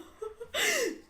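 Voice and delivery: very high, helpless real laugh; high-pitched laughter. Real, not acted. Cracking up at the storyteller's harsh jab, high squealing laugh.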